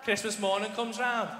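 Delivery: ordinary talk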